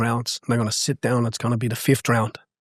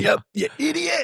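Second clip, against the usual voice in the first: high, hammed-up frustrated snap at an athlete; a coach pretending to lose it and snapping at a lazy athlete, hammed-up; mock angry outburst; 14 semitones higher, 4 dB quieter than usual. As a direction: play at losing your temper, half-laughing. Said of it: "kicking the bin over, going".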